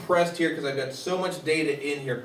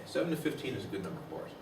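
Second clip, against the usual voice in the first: softer and fast, tossed off; quick soft repeat; 3 semitones lower, 11 dB quieter, much faster than usual. Shown as a demonstration. Lecturer repeating the rule to the class, quick and soft. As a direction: rattle the rule off again under your breath.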